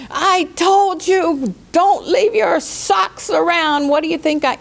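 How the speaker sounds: nagging parent telling off; scolding. Shown as a demonstration. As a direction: scold them for the socks. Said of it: "those are hard things to say".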